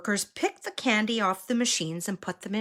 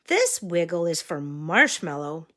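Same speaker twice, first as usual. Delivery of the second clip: sing-song picture-book voice; reading to a toddler; 3 semitones higher, 4 dB louder than usual. Acted. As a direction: point out each picture to a small child. Pointing at pictures for child.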